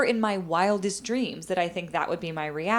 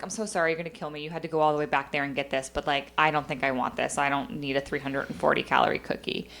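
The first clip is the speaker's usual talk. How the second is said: retold apology; 6 semitones lower, faster than usual.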